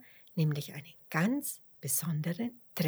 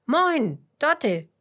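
loud, higher, hearty hello; fröhlicher Gruß; 8 semitones higher, 8 dB louder, slower than usual. A narrator's reading. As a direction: call out a keen greeting. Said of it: "grüßt Fitus". (fröhlich und laut grüßend)

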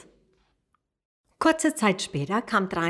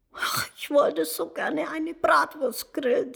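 high, tearful sobbing voice; a little pony weeping over its sausage; crying pony; 7 semitones higher than usual. Acted. Pony sobbing to the dragon, high and tearful. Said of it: "große Tränen kullerten über seine Wange".

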